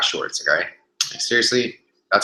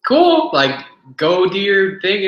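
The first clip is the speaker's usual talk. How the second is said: much higher, loud, slow carefree brush-off; breezy dismissal; 10 semitones higher, 5 dB louder, much slower than usual. Retold or imitated. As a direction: wave him off like you couldn't care less. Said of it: "I was just like".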